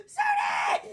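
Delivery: very high, loud panicked yell; tweaking. Retold or imitated. Stuck child yelling for sibling, tweaking. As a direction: scream for help, hammed-up.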